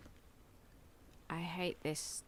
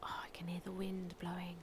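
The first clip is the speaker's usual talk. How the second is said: whispering; 10 dB quieter than usual. Real, not acted. Exploring quietly.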